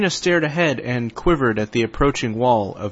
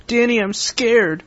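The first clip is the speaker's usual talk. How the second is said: a hint of a scared girl; 10 semitones higher than usual. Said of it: "she shouted, crying".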